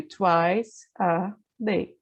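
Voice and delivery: once a day spelled out very slowly, word by word; careful, drawn-out model phrase; slow teaching demo. Shown as a demonstration. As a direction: stretch each word so students can copy. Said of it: "Duas vezes por dia".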